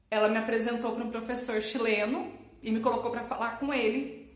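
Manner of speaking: ordinary talk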